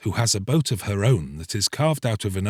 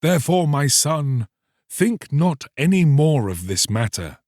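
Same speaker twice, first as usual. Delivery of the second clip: raised, solemn rebuke; horrified priest; 5 semitones higher than usual. Acted. Priest rebukes the fisherman, raised and solemn. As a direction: warn him off sternly.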